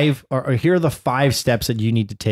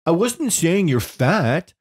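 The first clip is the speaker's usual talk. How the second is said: put-on guilty child; 7 semitones higher than usual. Dad imitating daughter caught in the wrong, stammering. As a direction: stall like a caught kid. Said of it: "this is her tell".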